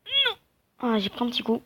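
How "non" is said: very high, louder yelp of "no"; sudden high-pitched squeal; panicked cry. Real, not acted. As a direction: cry out as you get caught. About to be caught in game.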